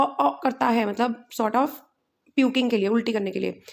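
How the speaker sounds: usual speaking voice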